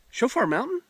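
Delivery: light imitation of a startled woman; surprised recognition. Woman realizing who the pastor is, imitated surprise.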